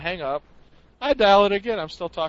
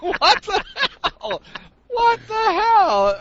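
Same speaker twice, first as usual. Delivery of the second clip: high, slow, louder what the hell; baffled high shout; 8 dB louder, much slower than usual. Retold or imitated. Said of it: "I'm like".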